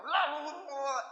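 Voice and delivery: high-pitched excited babble; hyper chatter. Retold or imitated. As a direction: rattle it off like a buzzing kid. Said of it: "'cause I'm like".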